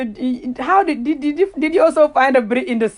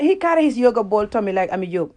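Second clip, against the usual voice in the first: ordinary talk, nothing marked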